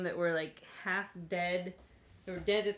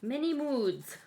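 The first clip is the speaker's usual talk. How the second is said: laughing; 5 semitones higher, 4 dB louder, slower than usual. Real, not acted. Teasing herself, laughing.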